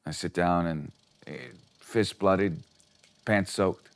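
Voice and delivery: usual speaking voice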